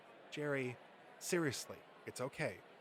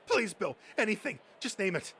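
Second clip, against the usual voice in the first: high, louder begging; urgent high-pitched plea; desperate pleading; 7 semitones higher, 6 dB louder than usual. Acted. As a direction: beg him like it's life or death. Begging for the toy head.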